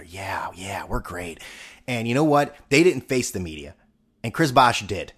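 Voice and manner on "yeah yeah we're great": hushed, breathy mock whisper; whispered aside between teammates. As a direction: whisper it like a secret pact. Quoted players whispering to each other.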